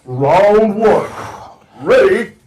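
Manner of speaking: loud fighting game announcer call; booming fighting game announcer. Acted. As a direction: call the round like an arcade game.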